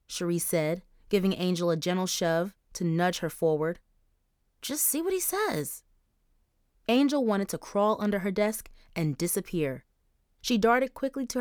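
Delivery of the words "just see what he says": put-on high girl voice. Charisse coaxing Angel.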